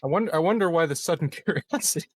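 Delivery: fast, giggling aside; laughing tease. Real, not acted. Player teasing the table, laughing quickly. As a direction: chuckle through the words.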